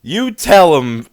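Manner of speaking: shouted, slowed hype call; cheering the rapper on, loud. Real, not acted. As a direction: egg him on, loud. Hyping up the rapper.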